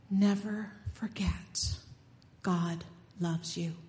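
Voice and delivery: a hushed, intimate reminder, slow; slow whisper. Acted. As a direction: slowly whisper never forget as a blessing. Preacher whispers the blessing.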